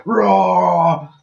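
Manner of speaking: loud, high pretend roar; comic movie-monster roar; mock roar. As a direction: roar it like a hammy villain.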